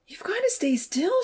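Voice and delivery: high, breathy plea; high-pitched begging; pleading. A narrator's reading. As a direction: urge him to keep still. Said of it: "she pleaded".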